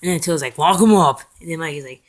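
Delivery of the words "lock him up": loud, lightly acted order; mock command. Attila orders a prisoner locked up, loud mock command. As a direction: imitate a boss giving a loud order.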